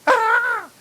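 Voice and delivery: sudden high startled yelp; mock startled cry. Preacher acting out being startled, sharp yelp. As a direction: jump as if someone startled you. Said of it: "pag nagugulat".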